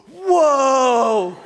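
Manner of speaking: put-on high, loud, amazed little kid; loud, slow, wide-eyed kid voice; child's wonder. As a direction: gasp it out like a stunned toddler. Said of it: "he looks right at this man and goes".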